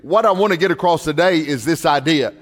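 ordinary delivery

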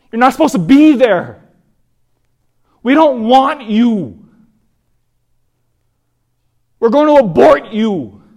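loud, high-pitched angry yell. Retold or imitated. Young mother rejecting her unborn baby, yelling loudly. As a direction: yell each rejection at full voice.

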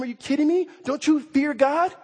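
high, loud incredulous rebuke. Acted. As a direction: snap at him in disbelief. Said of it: "he rebukes him".

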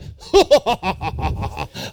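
villainous cackle from the belly; a gloating bad-guy mwahaha; evil laugh. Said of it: "I picture him with his evil laugh".

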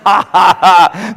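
staged, measured "ha, ha, ha"; God's mocking laugh. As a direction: laugh off the devil in separate ha's. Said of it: "He laughs. He's like".

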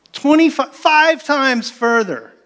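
high, slow stress on five times further; emphatic disbelief. Real, not acted. Preacher stressing Jonah's huge detour, high and slow. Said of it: "emphasizing distance".

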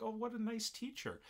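high, sugary praise; sweet girl voice. Retold or imitated. Olivia praising the teacher after he leaves, high sweet coo. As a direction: coo it like a naive schoolgirl. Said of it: "she's like".

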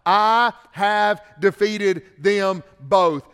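loud, slow, hammered preaching; preaching at full force. Acted. Preacher thundering victory over death to the congregation. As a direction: drive each line home to the congregation.

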